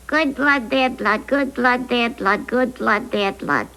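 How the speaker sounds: ventriloquist's fixed-lip "good blood, bad blood"; lips not moving. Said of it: "without moving my lips".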